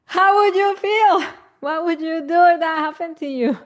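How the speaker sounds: high, loud and incredulous; how would you honestly feel; indignant question. Real, not acted. Asking parents, indignant.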